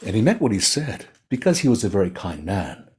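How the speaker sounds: ordinary delivery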